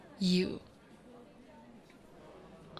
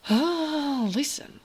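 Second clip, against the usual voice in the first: higher, louder drawn-out "oh"; exasperated affection; 7 semitones higher, 5 dB louder, much slower than usual. Acted. Orc woman answering her partner's doubt, exasperated affection. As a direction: push back on their doubt, voice lifted.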